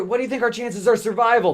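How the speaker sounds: high, fast worried question; nervous high question. Retold or imitated. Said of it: "That's what robot fucker says".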